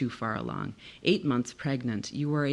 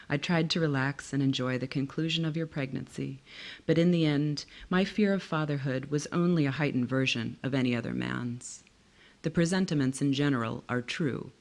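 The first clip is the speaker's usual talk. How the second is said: ordinary delivery, nothing marked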